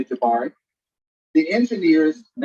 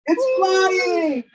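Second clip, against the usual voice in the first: higher and brighter cheer; excited; 10 semitones higher than usual. Real, not acted. Storyteller cheering the flying machine, higher excited call. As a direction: celebrate the launch.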